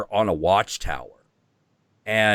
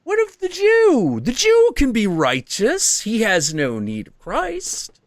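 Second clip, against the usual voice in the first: the red one's voice; 13 semitones higher, 3 dB louder, slower than usual. Retold or imitated. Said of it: "And the red one's like".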